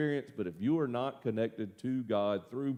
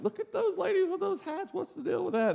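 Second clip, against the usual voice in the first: high squeaky voice, poking fun at the hats; a much higher put-on voice, gawking at the ladies; high mocking impression of convention guests; mock gossipy onlooker. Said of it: "and they're like".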